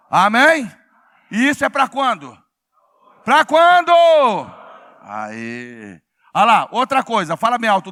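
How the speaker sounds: very loud, ringing pulpit delivery; full-force preaching. Real, not acted. Preacher rousing congregation to answer, full-force preaching. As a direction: rouse the congregation with big emphatic phrases.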